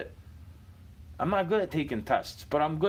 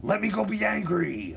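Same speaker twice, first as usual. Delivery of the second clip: mock-angry reply to a kind question; imitating angry brush-off; 6 semitones higher than usual. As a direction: throw it back like a sulky stranger. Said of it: "It's like".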